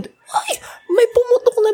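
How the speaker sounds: high startled cry as confetti bursts; shocked cry. Retold or imitated. Dancer reliving the confetti burst, high startled cry. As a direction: yelp as if the blast just hit.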